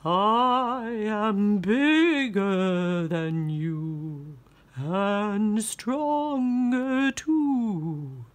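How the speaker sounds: slow, drawn-out boasting ghost; boastful ghost. Acted. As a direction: moan it proudly like a ghost. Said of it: "boasted the ghost".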